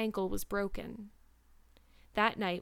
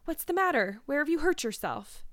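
high, urgent questioning; demanding; 6 semitones higher than usual. A narrator's reading. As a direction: demand answers in alarm. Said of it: "demanded Missus Barry".

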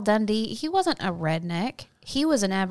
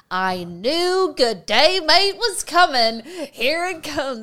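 slow, higher, groaning Aussie voice; mock Aussie listener; 8 semitones higher, 6 dB louder, slower than usual. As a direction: drag it out like a weary Aussie. Mocking Australian listeners' reaction.

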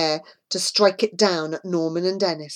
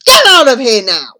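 very loud angry command; angry man ordering boys away; furious order; 6 semitones higher, 12 dB louder than usual. A narrator's reading. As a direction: drive them off with a shout. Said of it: "he snapped".

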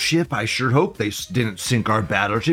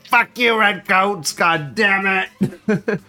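mock outrage; 7 semitones higher, much slower than usual. Mock outrage at British viewer.

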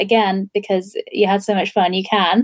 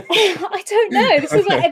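laughing; 10 semitones higher, slower than usual. Real, not acted. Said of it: "laughing after the pronunciation bit".

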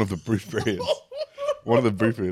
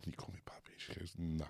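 hushed whisper retelling the request; 21 dB quieter than usual. Retold or imitated. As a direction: drop to a whisper as if confessing. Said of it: "I said".

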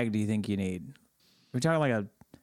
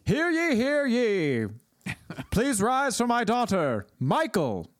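town crier; 8 semitones higher than usual. Pretend. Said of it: "I'm just imagining a guy in".

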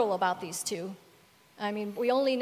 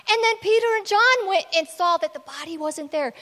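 higher, louder, running account; excited report; 5 semitones higher, 6 dB louder than usual. Retold or imitated. Cleopas telling a stranger about the tomb, excited. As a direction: pile up the news in a raised voice.

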